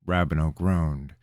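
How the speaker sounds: usual speaking voice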